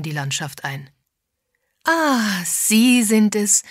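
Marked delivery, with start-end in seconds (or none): 1.8-3.6 high, loud, drawn-out welcome; slow, gleeful singsong hello; delighted greeting. A narrator's reading. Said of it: "begrüßte Bunty sie erfreut".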